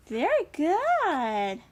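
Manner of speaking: big slow swoop of approval; delighted praise. Real, not acted. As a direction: stretch it out as you cheer them on. Praising the child's correct move.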